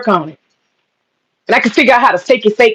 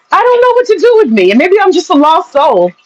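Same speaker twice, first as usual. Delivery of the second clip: much higher, hammed-up lament; mock despair; 6 semitones higher than usual. Unable to find victim tales, she laments in mock despair. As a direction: throw up your hands in fake despair.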